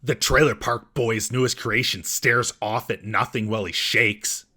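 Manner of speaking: usual speaking voice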